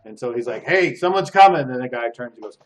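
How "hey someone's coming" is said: loud, raised alarm; guard's warning call. Acted. Guard calls out that someone is coming. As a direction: call out to warn the others.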